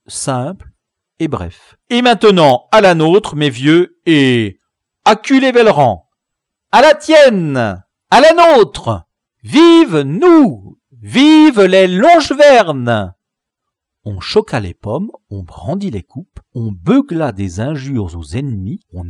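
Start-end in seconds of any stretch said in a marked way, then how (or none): 1.9-13.1 much higher, louder cheering toast; rousing toast. A narrator's reading. Chief Braque toasting his warriors, rousing shout. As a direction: raise the glass and rally the troops. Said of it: "formula le toast d'usage".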